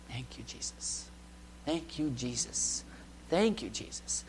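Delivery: soft, slow whispered prayer of thanks; hushed grateful whisper. Retold or imitated. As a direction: breathe the thanks out quietly, slowly. Remembering God's help, whispered thanks.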